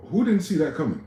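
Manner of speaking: loud high sarcastic question; sarcastic disbelief; mocking. Real, not acted. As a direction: throw the question out with scorn.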